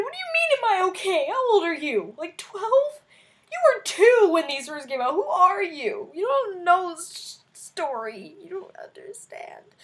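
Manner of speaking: high, loud hammed-up indignant wail; mock outrage. Retold tirade at the twelve-year-old, hammed-up wail. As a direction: rant at the kid, weepy and outraged. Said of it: "I sobbed like a small child".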